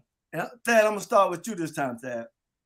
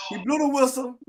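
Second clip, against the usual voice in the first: ordinary talk, nothing marked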